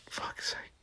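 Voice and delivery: exasperated whisper; whispered aside. Real, not acted. Reader exasperated at the fishnets line, whispered. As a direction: mutter it under your breath. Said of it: "unvoiced whisper".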